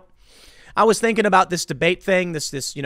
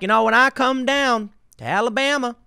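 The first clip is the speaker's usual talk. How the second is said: put-on Southern drawl, pitched up; mock a fake Southern twang, drawn out and higher; Hillary Clinton; 6 semitones higher than usual. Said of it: "she puts on a Southern drawl".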